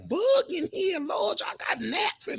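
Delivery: high startled cry about the bug; startled shriek. Real, not acted. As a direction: shriek at the gnat.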